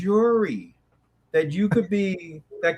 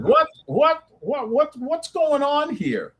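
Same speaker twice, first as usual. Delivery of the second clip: high-pitched, startled comic bison; talking bison; 8 semitones higher, slower than usual. Retold or imitated. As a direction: voice a baffled bison, stammering up high. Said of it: "The bison said".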